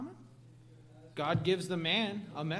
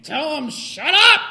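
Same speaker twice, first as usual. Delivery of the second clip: slow, loud, higher furious bark; inner angry shout; 8 semitones higher, 18 dB louder, slower than usual. Retold or imitated. Inner voice wanting to tell his brother off, loud angry shout. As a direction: let the inner rage burst out. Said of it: "Everything inside me saying".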